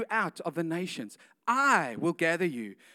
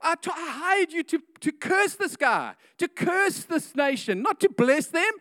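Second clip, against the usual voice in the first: high, loud outraged yell; furious king yelling no; angry king; 9 semitones higher, 6 dB louder than usual. Acted. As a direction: shout at the hired prophet for blessing.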